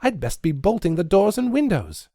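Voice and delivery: a hint of fright in her voice; frightened girl. A narrator's reading.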